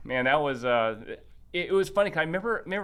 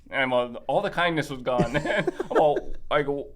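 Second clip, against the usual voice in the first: ordinary talk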